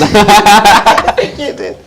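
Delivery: loud, slow laughing reply. Real, not acted. Host laughing at the guest's long answer, laughing loudly. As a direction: laugh through the words.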